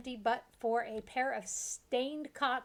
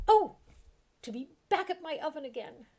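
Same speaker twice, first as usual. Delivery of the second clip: happy cook; 7 semitones higher, 7 dB louder than usual. Acted. Cook sighing happily.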